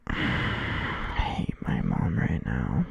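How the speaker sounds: breathy slow whisper, dragged out; a drawn-out whispered grumble. Real, not acted. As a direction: whisper it slowly to yourself, rising at the end. Muttering to himself while gaming.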